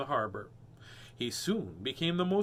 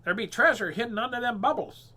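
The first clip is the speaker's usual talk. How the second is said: higher, louder parrot voice; parrot squawk; 6 semitones higher, 6 dB louder than usual. Acted. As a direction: squawk it with sly hope. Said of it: "squawked Parrot Polly".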